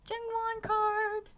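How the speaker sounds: high, loud, drawn-out singsong; gleeful reveal. Real, not acted. Trader revealing the card she was sent, gleeful. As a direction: show it off, stretching it out high and loud.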